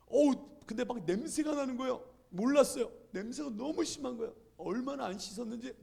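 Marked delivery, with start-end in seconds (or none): none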